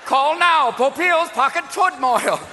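high, loud hammed-up TV ad shout; infomercial pitch. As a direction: sell it like a late-night infomercial.

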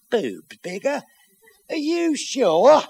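slow, drawn-out mock disbelief; incredulous echo. Husband doubting his wife's exercise plan, incredulous. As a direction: query it slowly, rising into scepticism.

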